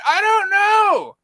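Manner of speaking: very high, slow, drawn-out whine; high, slow exasperated whine. Retold or imitated. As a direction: stretch it out, fed up. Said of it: "I'm like".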